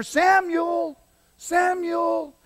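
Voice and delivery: high, long-drawn calling. Acted. God calls the boy back from sleep, high sustained calling. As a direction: call the name out high and drawn out.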